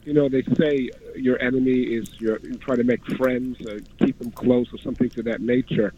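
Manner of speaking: ordinary talk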